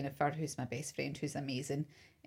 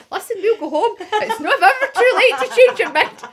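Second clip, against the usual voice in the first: much higher and louder, slowing to a laugh; mock heroic rescue; 17 dB louder than usual. To the crying bride, a hammed-up higher, louder rescue speech. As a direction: ham up a rescuer's grand promise. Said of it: "I'm just gonna say to her".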